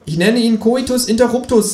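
high cheeky kangaroo. Acted. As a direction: answer like the cocky kangaroo.

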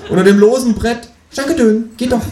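high, loud, happy kangaroo; cheerful kangaroo. Acted. Kangaroo thanks narrator and leaves, cheerful. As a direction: chirp it gleefully. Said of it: "sagt das Känguru fröhlich".

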